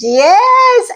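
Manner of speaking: a sudden high squeal of yes; excited yes. Real, not acted. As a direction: burst out with joy. Overjoyed at fans' tickets.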